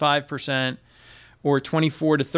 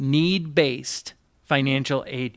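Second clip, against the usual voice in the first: slow, pointed repeat of "Need-based financial aid"; real slow; slower than usual. Shown as a demonstration. Host repeating the phrase to cohost who asked "for what", slow and deliberate. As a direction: stretch out "Need-based", leave space before "financial aid". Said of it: "Let's say that again real slow".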